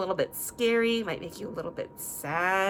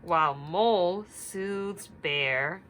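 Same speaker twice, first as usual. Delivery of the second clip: put-on reading to children; 4 dB louder, slower than usual. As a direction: read it gently to little listeners. Story reading.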